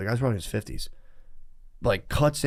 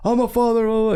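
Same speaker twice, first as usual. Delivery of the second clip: high, louder mocking outburst; mock indignant yell; 12 semitones higher, 5 dB louder than usual. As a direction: parody the man's protest in a high, louder voice. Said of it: "But he's like".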